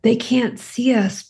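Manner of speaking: ordinary delivery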